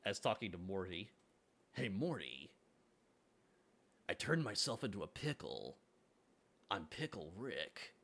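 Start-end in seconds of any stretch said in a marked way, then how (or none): 1.7-7.9 Solid Snake voice. Pretend. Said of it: "You need to do your Solid Snake voice".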